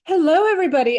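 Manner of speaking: ordinary talk, nothing marked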